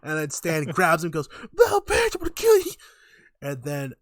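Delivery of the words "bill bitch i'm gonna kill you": very high, loud strained yell; over-the-top angry shriek; mock furious threat. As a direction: play a hammed-up attacker shouting. Stan grabs Bill and threatens him.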